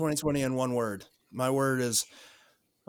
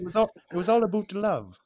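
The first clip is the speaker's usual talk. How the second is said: put-on Canadian accent; 6 semitones higher than usual. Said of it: "Is that your Canadian accent, [name]?".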